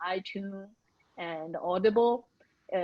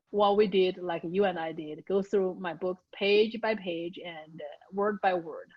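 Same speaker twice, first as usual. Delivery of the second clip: ordinary talk, nothing marked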